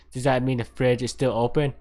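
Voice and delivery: ordinary delivery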